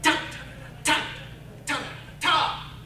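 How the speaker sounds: put-on punchy mouthed drum hits with accents; accented drum beats. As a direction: hit each beat with character. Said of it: "sounded like this".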